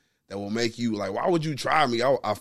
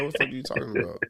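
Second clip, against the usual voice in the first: whispered disbelief; 4 dB quieter, much faster than usual. Retold or imitated. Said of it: "I'm like".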